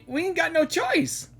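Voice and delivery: normal talking voice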